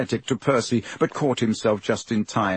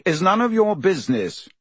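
stiff; 8 semitones higher than usual. A narrator's reading. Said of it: "he said stiffly".

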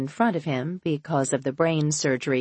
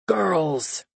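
thundering. Acted. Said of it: "thunders A J".

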